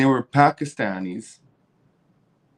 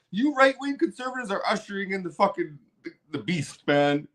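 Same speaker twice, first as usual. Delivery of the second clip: ordinary talk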